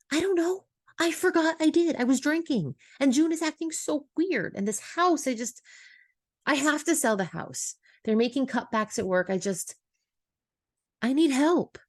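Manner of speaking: high-pitched, overwhelmed confession; distressed outpouring. A narrator's reading. Emma confessing her troubles to Jules, overwhelmed and high. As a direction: spill it all out in a high, upset voice.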